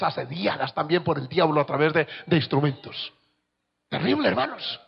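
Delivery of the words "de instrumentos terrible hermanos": normal talking voice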